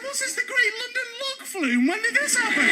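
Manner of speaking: high, puzzled disbelief; mock bafflement. On the dungeon log ride, high mock bafflement. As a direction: wonder aloud how a boat got here.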